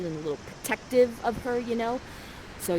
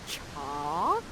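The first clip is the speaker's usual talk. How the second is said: lower, quiet dopey grunt; low dopey dude; 7 semitones lower, 6 dB quieter than usual. Acted. Ferdinand frozen by Miranda, in a low dopey grunt. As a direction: answer dumbly. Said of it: "is like this".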